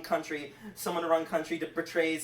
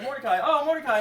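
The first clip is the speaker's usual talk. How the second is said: high, loud cry of the name; hammed-up burst of recognition; mock exclamation; 8 semitones higher, 9 dB louder, faster than usual. Acted call of Mordecai's name.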